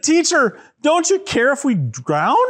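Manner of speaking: high, pleading mock panic; freaked out. Disciples begging their teacher in the storm, freaked out. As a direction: plead in pretend alarm. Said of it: "they were freaked out".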